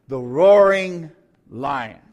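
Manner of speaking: The roaring lion, roaring stretched long and loud; slow stress on roaring. Shown as a demonstration. Preacher to congregation, drawn-out stress. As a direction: lean hard on roaring, slowly. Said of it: "everybody say roaring".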